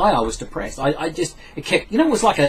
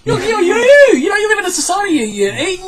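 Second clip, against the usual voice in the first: exasperated self-scolding; 14 semitones higher, 5 dB louder than usual. Retold or imitated. Retold self-talk, mocking himself.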